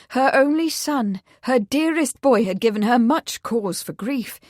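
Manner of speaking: ordinary delivery, nothing marked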